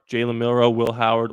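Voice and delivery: ordinary delivery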